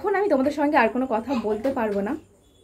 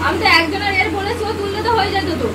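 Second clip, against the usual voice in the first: mock scolding; 6 semitones higher than usual. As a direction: pretend to blame them, pitched up. Fake scolding during the prank. (ভান করে বকুনি, উঁচু গলায়)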